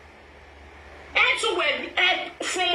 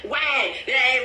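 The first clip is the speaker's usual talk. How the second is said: ordinary talk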